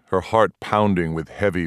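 ordinary delivery, nothing marked

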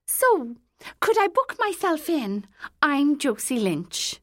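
high, eager middle-aged woman; pushy, delighted customer. A narrator's reading. Eager woman books herself in.

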